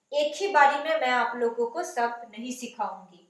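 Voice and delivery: ordinary delivery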